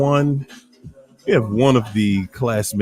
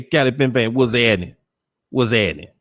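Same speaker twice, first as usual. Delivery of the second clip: usual speaking voice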